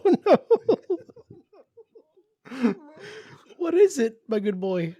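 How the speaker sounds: high sing-song talk to a dog; baby talk voice. Pretend. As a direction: coo at him like a pet. Mock pet-talk to absent friend.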